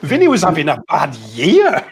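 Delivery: high, laughing through the words; chuckling at a friend's gloomy remark. Real, not acted. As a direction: laugh as you repeat it.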